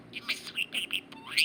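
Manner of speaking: whispery little bird voice copying words; chirpy talking budgie; parakeet chatter. Real, not acted. Parakeet echoes owner.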